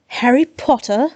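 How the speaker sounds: croaky East End Cockney voice; East End accent. Acted. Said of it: "he croaked in a distinctly East End accent".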